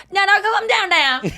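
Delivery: high mock yell, falling off; imitated reflex scream. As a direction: imitate a sudden yelp at a flying ball. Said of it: "it's not premeditated to scream".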